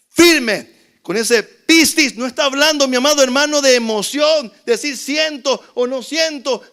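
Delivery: loud, high-pitched sermon storytelling; fiery preaching. Acted. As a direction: preach it at full force to the congregation. Preaching at full force.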